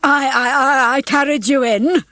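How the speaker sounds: high, loud, stuttered claim; eager boasting. A narrator's reading. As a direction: jump in loudly for the credit. Hens vying for credit.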